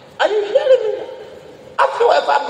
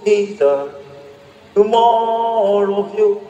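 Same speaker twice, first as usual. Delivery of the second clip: yearning prayer; slower than usual. Acted. Preacher voicing prayer to Jesus.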